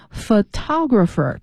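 photographer with the second syllable TO stressed; pho-TO-grapher, beat on TO. Shown as a demonstration. Teacher showing listeners the right stress of photographer, second syllable stressed. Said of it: "它的重音在涛第二个音节".

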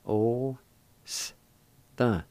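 O, s, the spaced apart; syllable by syllable. Shown as a demonstration. Teacher sounding out the first part. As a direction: sound out O, s, the one at a time. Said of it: "First part is pronounced like this".

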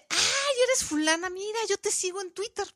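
high, gushing fangirl greeting; gushing fan. Retold or imitated. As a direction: play up the starstruck fan. Gushing greeting to a Twitter celebrity.

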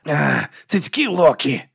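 slow growled curse. Acted. Wounded werewolf woman cursing, growling slowly. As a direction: growl it out in pain. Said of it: "видала низьке гарчання".

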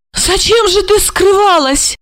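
high, anxious pleading voice; bewildered older woman. A narrator's reading. Olga pleads with Marina, high and bewildered. Olga's voice.

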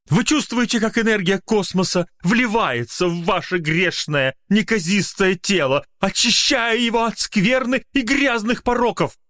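high, eerie hypnotist intonation; eldritch horror. Acted. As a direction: lure them into trance. Magician hypnotic speech. (гипнотический голос мага)

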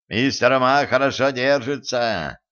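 usual speaking voice

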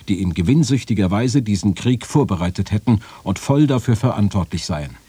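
ordinary talk

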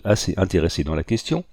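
plain reading voice

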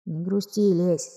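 very soft, breathy inner voice; whispery telepathic voice. A narrator's reading. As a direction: whisper it like a voice in her head. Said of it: "мысленно произнёс Проша". (шёпот мысленной речи)

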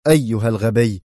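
irritated snap; angry interruption. Acted. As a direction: bite the word off. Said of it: "قاطعه بيمبوليني في حنق".

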